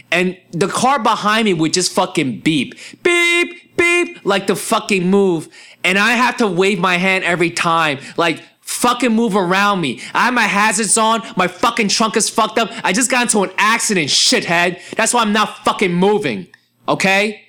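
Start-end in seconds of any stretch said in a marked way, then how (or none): 3.0-3.8 mock car horn. Driver mocking the car behind him: sharp high horn beep. 8.6-17.4 retold yell. Yelling back at honking drivers.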